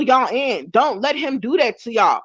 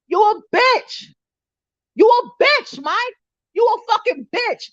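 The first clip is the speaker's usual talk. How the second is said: high-pitched angry shouting of insults; screaming insults at Mike, high and furious; furious yelling; 10 semitones higher, slower than usual. Real, not acted. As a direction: hurl each insult up high in rage.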